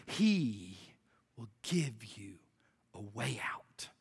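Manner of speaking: very low, soft, with long pauses; almost whisper it, phrase by phrase; hushed promise. Real, not acted. Preacher hushes the powerful statement.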